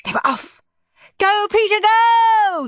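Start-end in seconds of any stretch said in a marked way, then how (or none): 1.2-2.7 high loud cheer; shouted. A narrator's reading. Mum cheers Peter from the sidelines, high and loud. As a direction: cheer your son on. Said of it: "shouted Mum".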